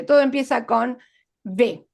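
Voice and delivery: ordinary delivery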